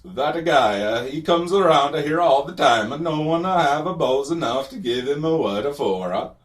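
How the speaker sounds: loud, high, hammed-up Italian accent. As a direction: play an over-the-top Italian immigrant vendor, excitedly. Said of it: "an Italian immigrant stereotype".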